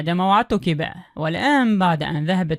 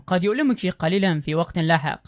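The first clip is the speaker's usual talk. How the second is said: usual speaking voice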